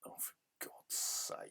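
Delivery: a worn-out hushed curse; exasperated whisper. Real, not acted. Gamer worn down by the door again, whispering.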